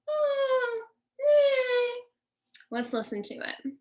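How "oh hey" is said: very high, horse-like owl whinny; high-pitched screech owl call. Shown as a demonstration. Teacher imitating the eastern screech owl, very high-pitched whinny. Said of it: "It sounds very high-pitched".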